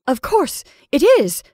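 high, loud, eager agreement; anxious agreement. Acted. Mrs Brigham agreeing with Caroline, anxious. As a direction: agree to convince yourself.

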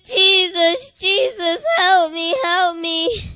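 high, loud, tearful calls; crying plea. Retold or imitated. Child crying to Jesus in vision, tearful and high. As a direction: cry out his name through tears.

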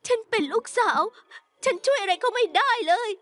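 tearful, high-pitched confession with gasping breaths; weeping with guilt; crying. Acted. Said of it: "อย่าร้องไห้สิ".

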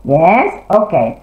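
normal talking voice

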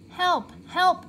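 high, loud yelp; crying for help. A narrator's reading. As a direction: shout for help in pain. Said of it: "cried the wolf".

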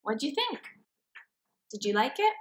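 plain reading voice

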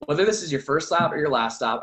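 ordinary talk, nothing marked

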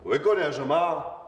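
drawn-out, high-pitched call; slow searching shout through the house. Acted. Man looking for his absent household, calling out.

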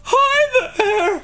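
loud high falsetto greeting; drag queen voice. Pretend. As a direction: trill out a high diva hi. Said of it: "What's your drag queen voice sound like?".

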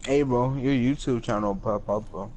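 ordinary talk, nothing marked

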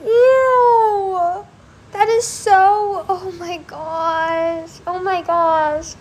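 high, loud, stretched-out "ew"; hearing about dissecting a fisheye; grossed out. Real, not acted. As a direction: drag out the disgust. Disgust at fisheye.

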